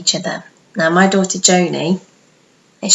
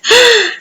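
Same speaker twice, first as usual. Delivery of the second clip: gasp; 5 dB louder than usual. Acted. Dramatic reaction.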